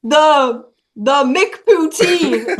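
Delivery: drawn-out, laughing repeat; slow, deliberate repeat. Retold or imitated. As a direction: spell it out slowly for a confused cashier. Repeating the order to cashier.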